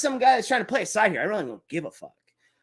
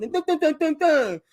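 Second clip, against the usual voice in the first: mocking dun, dun, dun with a higher pitch; mock dramatic sting; 7 semitones higher, 3 dB louder than usual. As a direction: hum dun dun high as a parody film sting. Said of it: "That's what you fucking sound".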